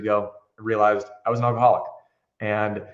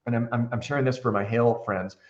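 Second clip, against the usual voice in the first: normal talking voice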